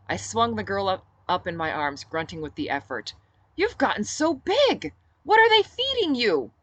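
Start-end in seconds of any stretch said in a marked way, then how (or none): 3.5-6.5 much higher, louder, slowed theatrical praise; playful delight. Acted. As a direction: ham it up for a small child. Captain teasing the child.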